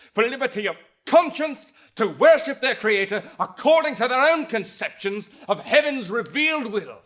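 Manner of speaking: rising to a loud, high climax; soaring, fervent declamation; triumphant oratory. Acted. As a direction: proclaim it at full force, ringing out each phrase. Climax of speech on liberty.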